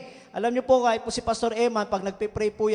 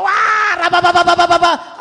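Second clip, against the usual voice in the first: loud, high rapid tongues babble; shouted tongues; 5 semitones higher, 10 dB louder than usual. Retold or imitated. Retelling leaving the bus, shouting in tongues. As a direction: cry out in tongues, overwhelmed. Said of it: "nag-speaking in tongues po ako".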